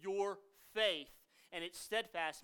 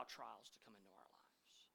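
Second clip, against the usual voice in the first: hushed whisper; 10 semitones lower, 21 dB quieter than usual. Real, not acted. Pastor confessing a hard truth to his church, whispered. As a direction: whisper it, solemn and unvoiced. Solemn admission to congregation.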